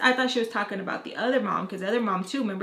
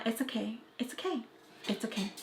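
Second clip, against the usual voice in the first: much softer, repeated reassurance; hushed restraint; 10 dB quieter than usual. Retold or imitated. Declining friend's offer to tell her, hushed. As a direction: brush off the offer very quietly.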